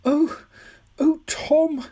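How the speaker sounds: put-on high old lady, full of feeling; old woman, moved. Old woman overcome at knowing Tom, high and moved. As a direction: sigh the boy's name in a high voice. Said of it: "murmuring to him softly and delightedly".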